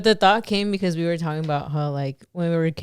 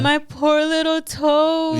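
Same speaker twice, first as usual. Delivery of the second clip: put-on slow, high babyish whimper; 8 semitones higher, much slower than usual. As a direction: drag it out like a pitiful little baby. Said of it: "like, a baby voice".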